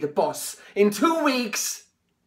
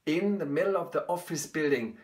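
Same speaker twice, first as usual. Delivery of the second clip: quiet; 5 semitones lower, 7 dB quieter than usual. Acted. Quiet confession.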